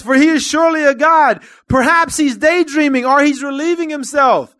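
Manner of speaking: high, loud mocking shout; scoffing shout. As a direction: jeer at them at full voice. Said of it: "he scoffed".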